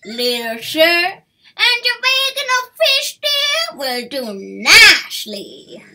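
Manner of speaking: put-on a cocky pirate raccoon lording it over the boy; loud, high roguish pirate demand; pirate raccoon bandit. A narrator's reading. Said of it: "shouted the bandit leader".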